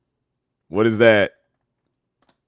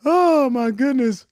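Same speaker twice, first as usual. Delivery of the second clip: ordinary talk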